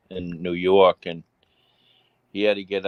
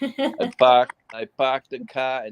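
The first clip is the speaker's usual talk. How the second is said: put-on Boston accent; 5 semitones higher, 5 dB louder than usual. Boston accent joke after accent talk.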